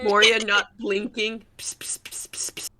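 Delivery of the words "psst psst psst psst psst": soft unvoiced psst calls; whispering. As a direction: whisper it like a sneaky lure. Mocking Moria's unblinking whisper.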